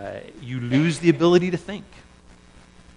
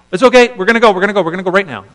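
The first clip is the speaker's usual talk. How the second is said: much higher, loud, hurried bravado; frantic eagerness; 8 semitones higher, 11 dB louder, much faster than usual. Retold or imitated. Israelites telling themselves to go, frantic and loud. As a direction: rush them out the door. People voiced rushing to go.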